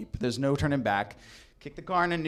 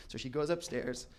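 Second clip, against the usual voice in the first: ordinary talk, nothing marked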